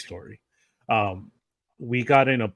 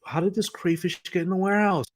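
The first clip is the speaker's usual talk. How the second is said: baffled surprise; 8 semitones higher than usual. Retold or imitated. Finding crayfish on warehouse floor.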